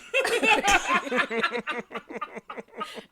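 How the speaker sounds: loud high laughter; big laugh. Real, not acted. Laughing at telling the producer she had nothing to smile about. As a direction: laugh hard at your own line.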